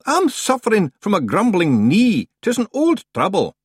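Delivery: a rustic Scot at the door; Scottish-ish stranger. A narrator's reading. Stranger Scots.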